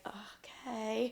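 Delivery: soft, drawn-out "okay"; small, hesitant mock acceptance; sheepish retold okay. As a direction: imitate a meek, embarrassed reply. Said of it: "It's like".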